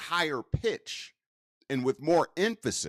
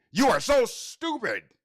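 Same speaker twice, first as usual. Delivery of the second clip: sharp, higher scolding tone; harsh scolding; 8 semitones higher than usual. Shown as a demonstration. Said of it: "But what if I said".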